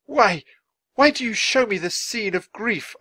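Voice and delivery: high, loud, anguished questioning; distressed plea. A narrator's reading. Man to ghost at a graveyard scene, distressed.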